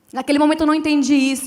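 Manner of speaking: ordinary delivery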